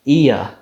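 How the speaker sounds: iya as "EE-uh"; English vowel ending. Shown as a demonstration. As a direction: come down from ee to "uh" in iya. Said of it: "he came down to uh".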